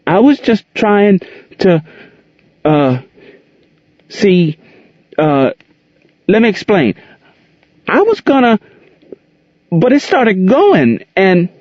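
high, halting scared voice; frightened boy. A narrator's reading. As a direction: stumble through it in fear.

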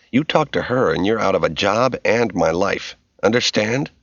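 ordinary delivery, nothing marked

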